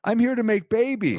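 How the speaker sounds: high innocent earnest voice; chirpy naive little character; sweet naive voice. Pretend. Voicing penis as sweet person.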